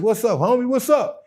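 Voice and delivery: excited greeting. Retold or imitated. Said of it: "I'm like".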